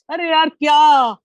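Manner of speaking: high, loud burst of admiration; amazed exclamation. Real, not acted. Reviewer bowled over by a poet's verse, amazed exclamation. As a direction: exclaim in delighted disbelief, voice jumping up.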